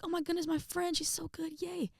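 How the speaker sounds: high, soft mock squeal of delight; retold gushing in a small high voice; excited cheering. Girl cheering her friend who got the solo, high excited gushing.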